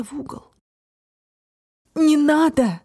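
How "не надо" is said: high, loud desperate outcry; desperate cry. Acted. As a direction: cry out to stop him. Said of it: "отчаянно вскрикнула Алис".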